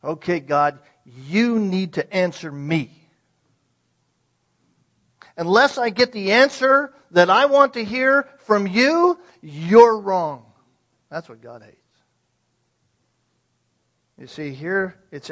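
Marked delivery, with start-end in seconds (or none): 0.0-10.4 loud, demanding ultimatum to God; demanding challenge. Acted. A defiant believer demanding answers from God, loud and indignant. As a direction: hurl the challenge upward, louder and louder. Voicing a contentious questioner.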